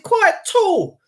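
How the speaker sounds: a high, eager guess; eager checking. Retold or imitated. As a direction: pin down who it is, excitedly. Checking it is the same man.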